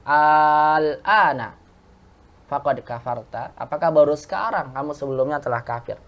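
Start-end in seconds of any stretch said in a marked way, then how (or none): 0.1-1.6 "Al ana" very slowly with held aa; long merged hamzah. Shown as a demonstration. Teacher quoting a Quran example, "Al ana" with lengthened a. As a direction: draw out the first vowel of "Al ana". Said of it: "Ini banyak contohnya".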